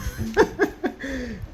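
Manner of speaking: short high-pitched laugh; a quick giggle bursting out. Real, not acted. Laughs at the hushed ladies.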